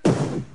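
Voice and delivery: a punch going poof; loud comic punch noise; poof sound. Pretend.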